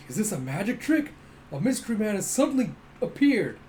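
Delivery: an astonished spectator; amazed. Retold or imitated. Crowd member at a sudden appearance, amazed exclaiming. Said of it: "And you see".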